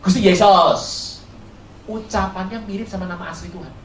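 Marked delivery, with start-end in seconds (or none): 0.0-0.8 loud Javanese "Yesus" sounded like "Jesos"; Javanese pronunciation. Retold or imitated. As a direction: say "Gusti Yesus" like a devout Javanese elder. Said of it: "Orang Jawa ngomong Yesus". (logat Jawa, "Yesus" diucapkan seperti "Jesos")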